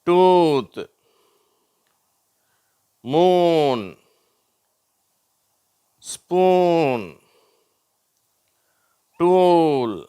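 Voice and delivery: "டூத்", "மூன்", "ஸ்பூன்", "டூல்" loud and high with stretched oo; long oo drill. Shown as a demonstration. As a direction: hold each oo and let it fall, one word at a time. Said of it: "ஊ என்ற ஓசை".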